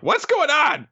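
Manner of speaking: very high put-on character voice; squeaky cartoon bewilderment; alarmed. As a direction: ask in high-pitched mock alarm. Alarmed character quote in recap.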